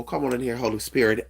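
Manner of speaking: ordinary talk, nothing marked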